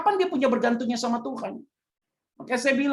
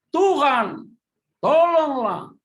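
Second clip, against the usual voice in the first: loud, high cry for help; Peter crying out; 6 semitones higher, 6 dB louder, much slower than usual. Acted. Preacher shouting Peter's cry as he sinks. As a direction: shout it like a man sinking in the water. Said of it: "lalu berteriak".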